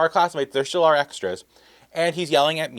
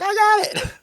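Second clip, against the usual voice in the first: very high, mock-triumphant squeal; excited novice; 4 dB louder than usual. Novice makeup helper proudly reporting to the assistant director, mock excited. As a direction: imitate an overeager beginner, pitched way up. Eager novice retold after slapping powder.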